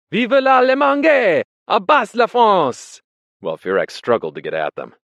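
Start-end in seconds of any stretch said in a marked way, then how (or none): 0.1-3.0 high, slow, full-volume chant-like yell; crowd's taunting shout. A narrator's reading. Whole bistro baits struggling Furex, taunting shout. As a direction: holler slogans to goad him. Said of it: "Everyone in the bistro began shouting together".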